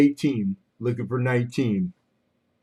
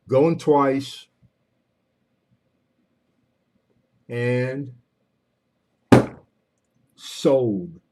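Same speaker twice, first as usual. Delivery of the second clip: auctioneer calling out the last bids; fish market auctioneer; slower than usual. Acted. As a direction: drive the bidding to the close.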